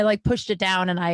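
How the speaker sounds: plain reading voice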